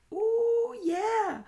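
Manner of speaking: squealed high ooh; a gleeful high gasp of surprise. Real, not acted. Reacting to a stabbing, high gleeful ooh.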